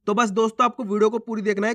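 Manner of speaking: ordinary talk, nothing marked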